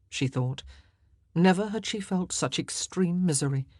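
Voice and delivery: ordinary delivery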